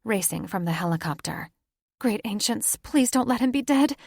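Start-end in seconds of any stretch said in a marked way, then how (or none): 2.0-4.0 high, loud, desperate begging; frantic plea. Acted. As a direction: plead with the gods in terror. Daughter begs over dying father.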